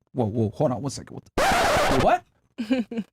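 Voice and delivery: shocked double take. Retold or imitated. Shocked at friend's cheaper price.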